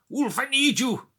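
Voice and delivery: high, loud Cockney Welsh gangster cry; over-the-top Cockney Welsh shout; Cockney Welsh accent. Retold or imitated. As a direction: yell it like a Welsh-Cockney villain calling his man. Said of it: "in his Cockney Welsh accent".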